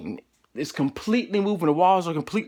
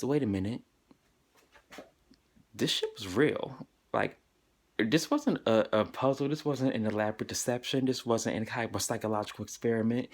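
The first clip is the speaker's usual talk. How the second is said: sobered realization; 6 semitones lower, 6 dB quieter than usual. Real, not acted. Telling the audience the truth sank in, quiet sober realization. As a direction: let it sink in quietly.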